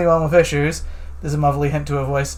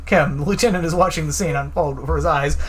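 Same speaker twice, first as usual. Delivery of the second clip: ordinary talk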